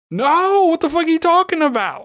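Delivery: high, loud incredulous outburst; outraged disbelief. Real, not acted. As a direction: yell at the screen in disbelief. Outraged at the heroine.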